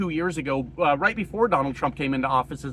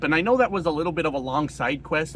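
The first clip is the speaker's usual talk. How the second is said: usual speaking voice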